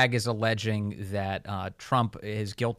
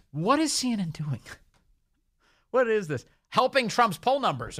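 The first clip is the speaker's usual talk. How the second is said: incredulous; 8 semitones higher than usual. Real, not acted. Host mocks CNN reading.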